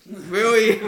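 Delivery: high, loud mock disbelief with a laugh; hammed-up incredulous question; exasperated outburst. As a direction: repeat the imitated complaint, breaking into laughter. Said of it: "Iron Bull finally goes".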